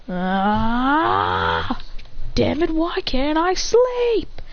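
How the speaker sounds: drawn-out groan into a high, slow whine; groan, then complain high and stretched out; acted frustration. Character still awake at midnight, frustrated high wail.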